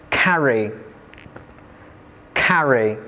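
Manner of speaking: "carry" with the beat on "car"; first-syllable stress. Shown as a demonstration. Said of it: "the stress is placed on the first syllables".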